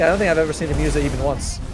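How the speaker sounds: ordinary talk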